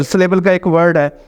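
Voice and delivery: ordinary delivery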